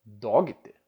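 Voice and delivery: "dogter" read as DOG-ui-te, with a short o and hard g; Portuguese-style misreading. Shown as a demonstration. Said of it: "Provavelmente você lê dogter".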